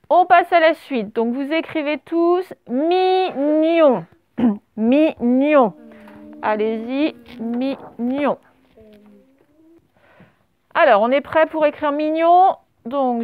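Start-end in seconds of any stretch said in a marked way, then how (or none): 2.7-4.1 syllable-by-syllable dictation. Shown as a demonstration. Teacher dictates a new word to the class, dictation. 4.8-5.7 syllable-by-syllable dictation. Shown as a demonstration. Teacher dictates a new word to the class, dictation. 7.4-8.4 syllable-by-syllable dictation. Shown as a demonstration. Teacher gives the word a last time, dictation.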